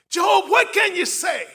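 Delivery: loud high challenge at Job; full-voice shout; shouted demand. Acted. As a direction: throw the question at him hard. Friends demand an answer.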